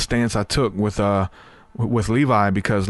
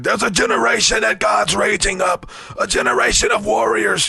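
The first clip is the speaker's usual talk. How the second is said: high, shouted prophetic preaching; rally the kids like a fired-up preacher; Lou Engle impression; 10 semitones higher than usual. Lou Engle preaching over the kid in the documentary, high shout. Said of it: "Lou Engle was in the Jesus Camp documentary".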